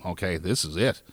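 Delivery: low, soft, resigned murmur. Retold or imitated. Choking man alone in the bus bathroom, low resigned murmur. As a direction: say it like giving up in the bathroom. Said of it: "sitting in a bus bathroom going".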